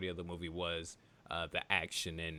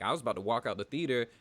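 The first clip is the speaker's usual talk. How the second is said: ordinary talk, nothing marked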